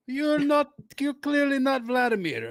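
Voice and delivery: high, put-on Russian accent; jokey Russian accent voice; mock Russian accent. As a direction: play a comic Russian official. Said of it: "sliding out of Russian so fast with that accent".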